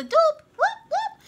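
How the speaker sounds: very high, loud celebratory whoops; whoop whoop cheer. Real, not acted. Crafter cheers a duplicate card with very high loud whoops. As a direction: cheer like at a party.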